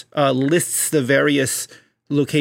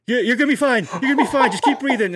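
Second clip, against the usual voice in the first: higher, hammed-up war-movie medic; mock medic reassurance; 8 semitones higher, faster than usual. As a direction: reassure the dying soldier, half laughing. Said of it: "And they're just like".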